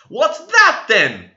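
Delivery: very high and loud retort; the servant showing her bruise in defiance; sharp retort. A narrator's reading. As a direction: fling it back as proof. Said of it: "I retorted".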